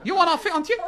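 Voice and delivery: high-pitched mock Chinese accent, asking; comic chip-shop man, pitched well up. Comedian mimics the chip-shop man to the audience, high mock Chinese accent. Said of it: "And he says".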